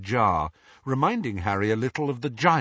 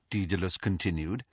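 ordinary delivery

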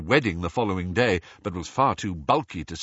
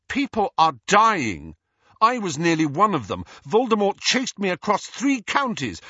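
raised, high-pitched angry outburst; indignant tirade; 8 semitones higher than usual. Acted. Harry berates the Minister as people are dying, indignant. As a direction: challenge him, hitting key words hard.

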